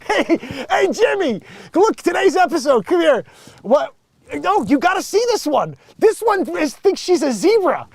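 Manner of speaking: high, loud mocking alien audience; alien viewers. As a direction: call your friend over to laugh at the humans. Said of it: "They're like".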